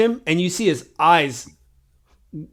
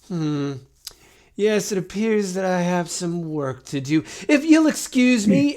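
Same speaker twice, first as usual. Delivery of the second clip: put-on high, polite old voice, rising at the end; dutiful mummy; 4 semitones higher than usual. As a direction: excuse himself in a prim high voice. Mummy sees a dead body.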